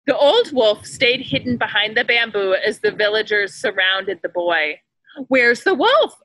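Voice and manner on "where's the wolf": louder, higher alarmed call; villager crying out. A narrator's reading. Villager searching for the wolf, calling out loudly. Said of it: "a villager cried out".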